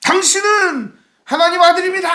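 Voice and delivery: hammed-up shout acclaiming the Son of God; mock demon shout. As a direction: yell it like someone falling prostrate. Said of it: "소리를 질러요".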